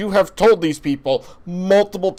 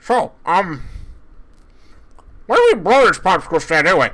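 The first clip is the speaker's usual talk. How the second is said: talking through a mouthful of food; words muffled by a big bite; mouth full; 4 semitones higher than usual. Acted. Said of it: "You have all of my food in your mouth".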